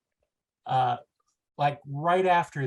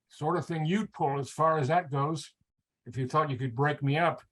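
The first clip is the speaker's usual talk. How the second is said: mild grumble. Acted. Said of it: "Nimoy grumbled".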